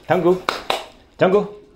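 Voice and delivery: high calling voice; talking to a dog. Real, not acted. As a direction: call the dog's name, high and bright. (appel du chien, aigu et fort)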